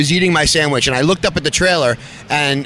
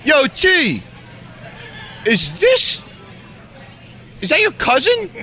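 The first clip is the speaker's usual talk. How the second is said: high, calling-out voice to a man across the room; retold yell across the room; 10 semitones higher than usual. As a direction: call it over like hailing someone far off. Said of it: "Carl yells over".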